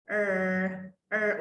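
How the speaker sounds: "ار" as a low, held American R; American R sound. Shown as a demonstration. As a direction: hold "ار" low with the tongue curled. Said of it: "اوري الناس النطق ازاي". ("ار" بلسان ملفوف)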